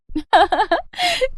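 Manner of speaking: put-on high-pitched peal of girlish laughter; teasing laugh. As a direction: laugh mockingly at a friend's match. Friends tease the bride-to-be.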